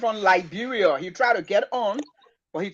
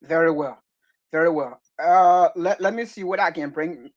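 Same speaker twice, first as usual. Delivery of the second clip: ordinary talk, nothing marked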